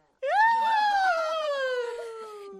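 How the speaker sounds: high, drawn-out shocked cry; shocked wail. Real, not acted. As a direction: wail it out in mock horror. Reacting to harsh story. (大げさに驚いた叫び)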